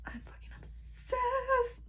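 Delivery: high, slow, hushed whisper; intense whisper. Real, not acted. Confessing love for her laptop, a slow high whisper. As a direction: whisper it like a secret obsession.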